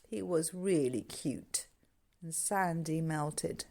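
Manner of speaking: tender. A narrator's reading. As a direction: let the narration melt. Tender narration.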